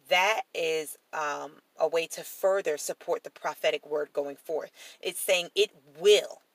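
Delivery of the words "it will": WILL stressed and louder; "it will", with a firm punch on WILL; emphatic WILL. Shown as a demonstration. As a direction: land hard on "will". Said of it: "in an emphatic way".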